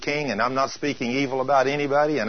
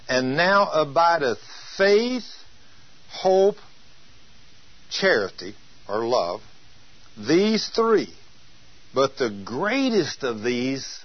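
slow, solemn reading of scripture; slower than usual. Acted. Preacher reading scripture slowly to church. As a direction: read the verse slowly to the congregation.

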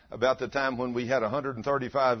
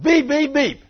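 mock car horn; 14 semitones higher, 10 dB louder, slower than usual. Honking at car, imitating horn.

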